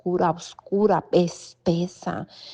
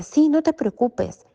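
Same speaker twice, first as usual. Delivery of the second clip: higher, louder quick comfort; eager reassurance; 8 semitones higher, 4 dB louder than usual. Retold or imitated. Answering her comadre's plea, higher and eager. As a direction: soothe her with a lift in the voice.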